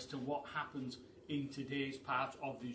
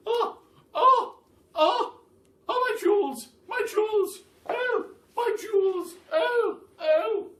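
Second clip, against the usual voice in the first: very high, loud, sobbing wail; dramatic fainting Duchess; 15 dB louder than usual. Acted. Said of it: "in a dramatic way".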